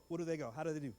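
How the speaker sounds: usual speaking voice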